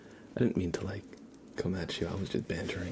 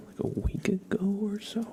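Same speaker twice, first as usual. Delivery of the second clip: whisper; 12 semitones higher than usual. Real, not acted. Streamer greeting viewers in chat, whispering. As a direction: breathe the words gently.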